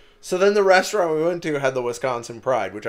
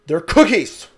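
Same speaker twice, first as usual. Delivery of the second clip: exasperated; 9 semitones higher, 8 dB louder than usual. Retold or imitated. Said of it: "I get infuriated".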